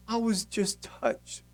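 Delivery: soft, high, slow weepy reply; mock tearful. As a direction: imitate a girl tearfully confessing. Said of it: "Why are you crying?".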